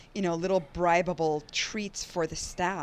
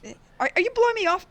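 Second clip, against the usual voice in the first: high, fast and loud; a hammy, offended squeal of surprise; mock offended; 13 semitones higher, 7 dB louder, much faster than usual. Host dodges the question.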